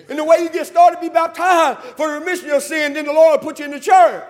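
loud, high-pitched sermon delivery; full-force preaching. Real, not acted. Preacher urging the lost to be baptized, full-force preaching. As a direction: preach it out to the whole church.

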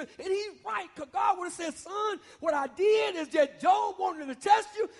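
shouting God's reply to Job; preaching shout. Acted. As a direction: voice God's answer with full force. Preacher voicing God, shouting.

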